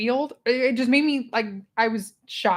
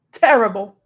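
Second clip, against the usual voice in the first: louder, punched verdict; a loud flat judgement; emphatic repeat; 7 dB louder than usual. Real, not acted. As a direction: slam the word down.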